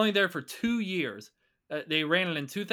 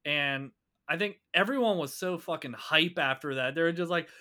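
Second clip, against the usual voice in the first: usual speaking voice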